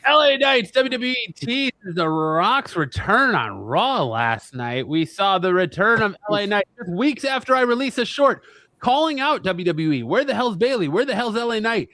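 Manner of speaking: hyped show-host read; announcer. Acted. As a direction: punch it like a wrestling promo. Reads topic like show host.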